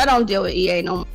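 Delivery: usual speaking voice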